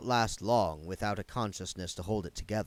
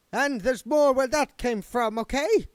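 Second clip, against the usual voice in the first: much higher, louder swagger after a win; cocky spirit guide; 7 dB louder than usual. Acted. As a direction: crow over the beaten ghost.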